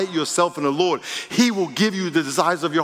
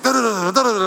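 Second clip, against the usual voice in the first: higher, louder nonsense jabber; mock ranting accuser; 6 semitones higher, 3 dB louder, faster than usual. Preacher mocking the man who accused him, jabbering. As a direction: rattle it off like someone coming at you. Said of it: "And he was like".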